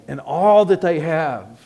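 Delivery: a hammed-up bargaining pitch to the townsmen; selling pitch. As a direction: sell it like a smooth dealer. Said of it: "here's the big selling point".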